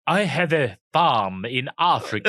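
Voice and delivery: slow, hammed-up fake South African accent, laughing; Val Kilmer's accent. Mocking Val Kilmer in The Saint, a slow laughing fake accent. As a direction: drawl out a bad Hollywood Afrikaner. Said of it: "Was Val Kilmer in ... The Saint".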